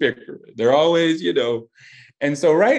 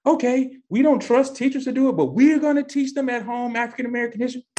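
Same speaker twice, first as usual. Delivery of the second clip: high, quick voice for hypothetical parents; lightly acted parents, pitched up and hurried; imagined parents speaking; 12 semitones higher, faster than usual. Hypothetical parents explaining their home-teaching plan, in a high, quick voice. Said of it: "if they're saying".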